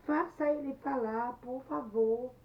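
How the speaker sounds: urgent plea. Acted. Anete begs Luiz's mother for help, pleading urgently. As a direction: beg the mother to step in.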